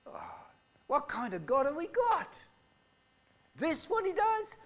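a rising, lightly voiced grumble of disbelief; complaining Israelite. Israelite in the desert complaining about God, in a lightly voiced indignant grumble. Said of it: "you would say".

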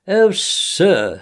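high tearful plea as a put-on washerwoman; hammed-up crying. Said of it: "crying afresh".

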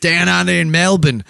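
light mock Aussie accent; Australian accent, lightly played. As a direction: say it like a Melbourne local. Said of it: "You do a really good Australian".

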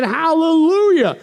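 high, drawn-out hallelujah shout; slow, soaring acclamation; shouted praise. Acted. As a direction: cry it out like a heavenly multitude. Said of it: "again they shouted".

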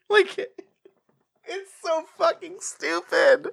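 ordinary talk, nothing marked

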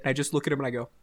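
ordinary talk, nothing marked

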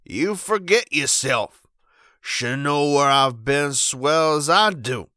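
put-on slow, loud drunken elder; lofty but slurring old man; swaggering drunk showing off his hat; tipsy grand dignity. A narrator's reading. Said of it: "he said with grand dignity".

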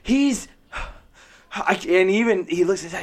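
ordinary delivery

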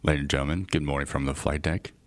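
low, soft, smooth captain announcement; calm captain over the intercom; pilot PA announcement. Shown as a demonstration. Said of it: "do it, do it".

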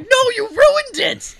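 high, loud, slow imitation of a furious kid; mock outraged wail. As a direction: stretch the accusation into a yell. Kid blames dad for wet Xbox.

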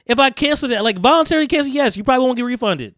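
high, loud heated rant. Real, not acted. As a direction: rant with rising frustration. Angry rant about refund.

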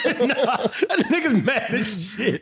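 slow laughing retold remark; laughing quote. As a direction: crack up while quoting him. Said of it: "I said".

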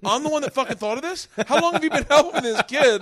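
loud, high-pitched ranting; retold incredulous outrage. Parent berating the aides helping his child, incredulous. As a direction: demand answers, dumbfounded.

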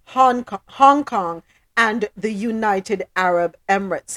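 ordinary delivery

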